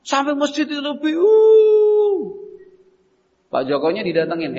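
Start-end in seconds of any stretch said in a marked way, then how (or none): 0.0-2.4 put-on slow, high little boy's complaint; crying child. Small boy telling his father he was hurt, tearful. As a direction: whimper it like a hurt small kid.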